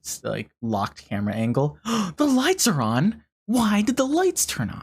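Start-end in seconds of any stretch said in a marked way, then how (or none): 1.8-4.8 gasp, then a much higher alarmed question; real shock, voice jumping up; startled surprise. Real, not acted. Streamer sees the lights suddenly come on, startled.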